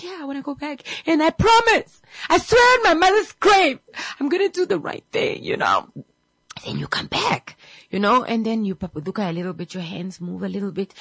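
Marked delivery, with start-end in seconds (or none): 0.0-5.4 high, earnest begging; high pleading promise. Retold or imitated. Patient promising God he will do right, high eager plea.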